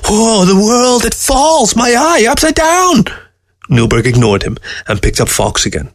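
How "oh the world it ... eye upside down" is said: high, wailing creature voice; screeching weasel. A narrator's reading. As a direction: screech in dizzy panic. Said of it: "screeched Weasel".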